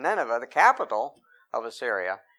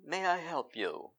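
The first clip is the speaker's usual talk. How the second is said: mock polite butler; 3 semitones higher, 9 dB quieter than usual. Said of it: "he didn't even come to Judah and say".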